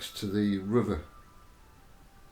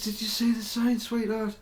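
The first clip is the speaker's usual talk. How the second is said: calling out; 10 semitones higher than usual. Retold or imitated. Calling out across distance.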